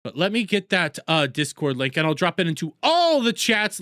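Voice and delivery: ordinary talk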